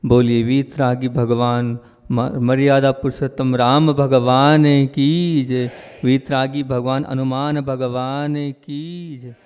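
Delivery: ringing call-and-response invocation, stretched endings; devotional invocation call. Real, not acted. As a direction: lead the crowd in a drawn-out jaykar. Leads the crowd's invocation.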